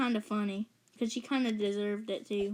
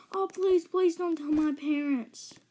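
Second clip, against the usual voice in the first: high, anxious begging imitation; mock pleading; 5 semitones higher than usual. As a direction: beg like a caught babysitter. Babysitter begs the mom.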